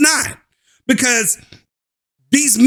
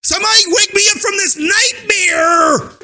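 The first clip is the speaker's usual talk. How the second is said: voicing the girls; 8 semitones higher than usual. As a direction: wail it like a trapped girl. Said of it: "It's like".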